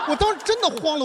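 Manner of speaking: usual speaking voice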